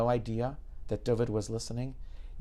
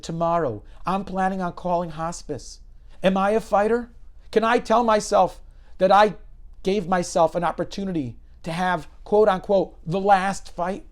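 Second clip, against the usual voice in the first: louder and higher, stirred up; urgent self-questioning; 7 semitones higher, 8 dB louder than usual. Retold or imitated. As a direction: fight for the answer. Dying man's urgent self-questioning.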